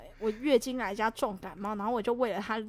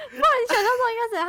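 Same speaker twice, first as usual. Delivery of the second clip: challenging retort; 12 semitones higher, 11 dB louder, faster than usual. Retold or imitated. Acting out her own retort.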